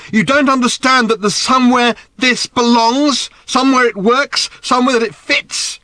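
high, loud and impassioned; angry outburst. A narrator's reading. Daughter brandishing the watch at her father, angrily shouting. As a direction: cry it out in anger. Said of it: "her face dark with anger".

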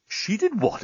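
high, hushed disbelief; incredulous high question; shocked exclamation. A narrator's reading. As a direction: exclaim it softly in shock, pitch leaping. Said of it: "exclaimed Dan".

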